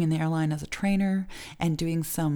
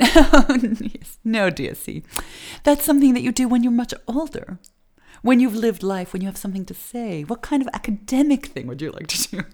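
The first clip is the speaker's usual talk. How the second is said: higher, hammed-up prim English counselor; snooty English accent; 5 semitones higher, 8 dB louder than usual. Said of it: "that sort of snooty accent".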